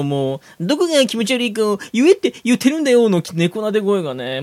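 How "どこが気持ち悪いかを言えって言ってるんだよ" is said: high, lightly cooing imitation; coaxing voice. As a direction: mimic a sugary coaxing tone. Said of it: "その猫なで声だもの".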